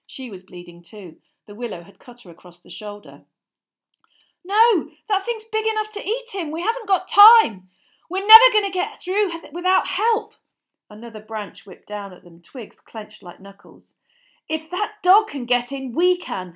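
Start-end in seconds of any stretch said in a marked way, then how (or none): 4.4-10.3 high, loud and urgent; urgent boy's voice. A narrator's reading. Harry telling Hermione they need help, high urgent. 14.5-16.5 loud, breathless and urgent; panting. A narrator's reading. Harry dodging branches, panting loudly. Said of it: "Harry panted".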